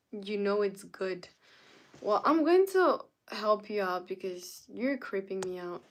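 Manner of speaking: normal talking voice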